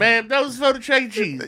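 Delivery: ticket clerk voice. Retold or imitated. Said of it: "repeat of the quote".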